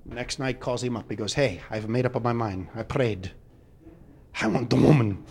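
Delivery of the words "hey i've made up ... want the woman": Pope. Acted. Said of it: "The pontiff thanks him".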